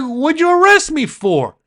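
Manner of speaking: high, loud and slow; retold indignant protest. Arrested driver protesting to the deputy, indignant yell. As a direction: demand to know why.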